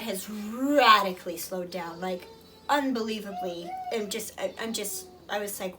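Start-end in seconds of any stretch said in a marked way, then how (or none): none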